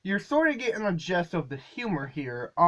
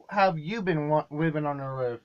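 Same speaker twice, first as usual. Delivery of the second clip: ordinary delivery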